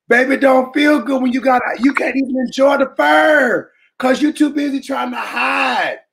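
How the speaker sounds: usual speaking voice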